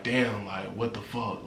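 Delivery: low, hushed muttered disbelief; quiet dismay. Retold or imitated. Inmate to himself on facing ten days, quietly dismayed. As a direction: say it under your breath, sinking. Dismayed inner reaction in jail.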